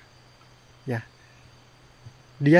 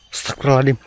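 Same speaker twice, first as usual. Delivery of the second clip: startled exclamation; 8 dB louder, much faster than usual. Real, not acted.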